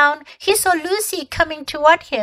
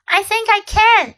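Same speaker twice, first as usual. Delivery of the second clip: put-on boy's voice, higher and louder; eager schoolboy answering the queen; young boy; 10 semitones higher, 4 dB louder than usual. A narrator's reading. Edmund voiced, boy's reply.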